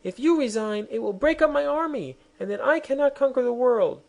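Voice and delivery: queen dismayed at losing her only private; agitated queen. Acted. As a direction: plead to keep her army. Queen keeps arguing.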